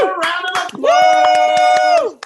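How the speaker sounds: slow, high, loud MC holler; calling for applause. Real, not acted. Host to audience after an act, calling for applause. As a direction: stretch it out over the cheering.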